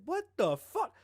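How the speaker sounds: imitated startled yell; shocked. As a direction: yelp it in shock. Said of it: "He looked over, he said".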